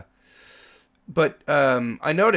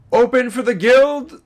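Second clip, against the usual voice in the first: loud, high, drawn-out call; gatekeeper's shout; 12 semitones higher, 13 dB louder than usual. Acted. Guard orders the doors opened for the guild, official shout. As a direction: call it out to open the gates. Guard calls to open gate.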